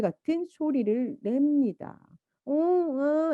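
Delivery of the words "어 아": 높게 아기 말소리 흉내; 아기처럼 "어, 아"; baby speech sounds. Shown as a demonstration. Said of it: "이런 소리를 내요". (아기 말소리 흉내)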